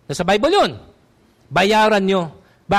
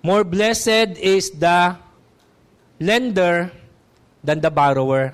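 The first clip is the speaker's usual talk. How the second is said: slow emphatic proverb; 3 semitones lower, slower than usual. Real, not acted.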